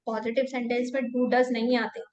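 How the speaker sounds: ordinary talk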